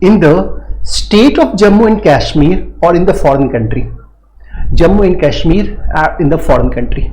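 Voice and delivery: reading the law text aloud word by word, very slowly; slow statute reading. Shown as a demonstration. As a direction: spell out the section slowly for students. Teacher reads statute aloud.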